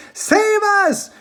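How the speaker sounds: put-on high, loud old woman's shriek; frightened cry. A narrator's reading. Grandma Josephine crying out as the roof caves in, high and loud. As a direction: cry out in terror. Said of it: "cried Grandma Josephine".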